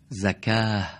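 زکاه said with an Arabic accent, both vowels a short front a; زکاه in an Arabic accent, falling at the end. Shown as a demonstration. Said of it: "نو لهجهٔ عرب بگیم". (زکاه با لهجهٔ عربی)

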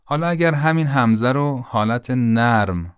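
normal talking voice

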